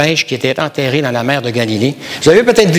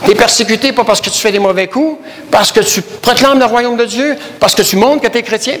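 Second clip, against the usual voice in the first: prêche qui monte en intensité; 6 semitones higher than usual. Acted. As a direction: build the sermon toward its climax. Preacher building intensity. (prédicateur qui presse son auditoire, voix plus haute)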